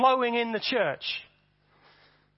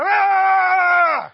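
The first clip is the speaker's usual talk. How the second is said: high, very loud war cry; Viking yell; 10 semitones higher, 12 dB louder than usual. Retold or imitated. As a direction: roar like a Viking. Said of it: "a bit of Viking blood in her".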